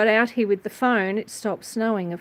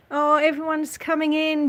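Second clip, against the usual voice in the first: ordinary talk, nothing marked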